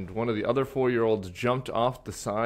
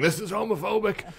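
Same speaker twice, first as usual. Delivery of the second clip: high-pitched mock indignation; mock outrage; 10 semitones higher than usual. Panelist imitating an indignant quote tweet, high mock outrage. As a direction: exaggerate the outcry, pitched way up. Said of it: "quote tweet it, saying".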